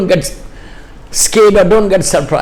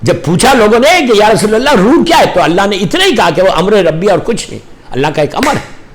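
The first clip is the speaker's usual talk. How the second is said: ordinary talk, nothing marked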